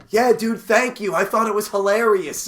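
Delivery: high, loud, gushing thanks in an effeminate Puerto Rican voice; effeminate Puerto Rican man. Acted. Comic thanking a fan offstage, effeminate Puerto Rican voice. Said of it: "a rather effeminate Puerto Rican man".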